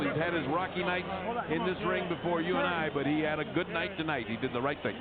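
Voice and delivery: ordinary talk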